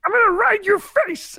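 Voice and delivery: very high, loud diva pig voice; shrieking pushy pig puppet; Miss Piggy. Acted. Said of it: "doing a Miss Piggy".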